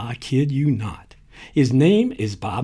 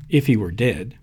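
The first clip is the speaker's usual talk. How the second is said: usual speaking voice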